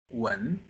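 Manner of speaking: rising "wen"; second tone. Shown as a demonstration. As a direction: lift "wen" upward like a question. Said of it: "the second tone from here to here".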